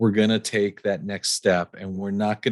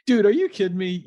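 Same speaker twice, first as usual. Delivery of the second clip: amazed disbelief; 10 semitones higher than usual. Real, not acted. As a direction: pitch jumps high in delighted disbelief. Host reacts to family's support.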